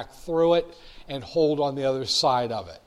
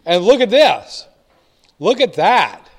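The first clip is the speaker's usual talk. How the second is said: louder, excited look at this; emotional; 4 semitones higher, 9 dB louder than usual. Real, not acted. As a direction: get worked up over the chart, loud. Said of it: "I tend to get very emotional about this".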